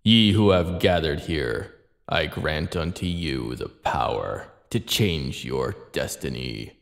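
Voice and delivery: deep, slow, distant voice; solemn promise. Acted. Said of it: "seemed to hear a solemn voice".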